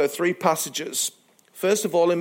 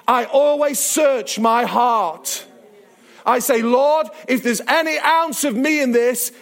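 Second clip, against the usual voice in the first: raised, higher-pitched sermon delivery; impassioned preaching; 7 semitones higher, 3 dB louder than usual. Acted. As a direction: drive the point home to the congregation. Preaches to congregation at full force.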